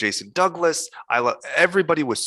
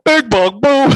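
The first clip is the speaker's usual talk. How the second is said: much higher, loud squealed catchphrase; hammed-up fan heckle; 13 semitones higher, 11 dB louder, slower than usual. Fans harass the host in the street, squealing his catchphrase.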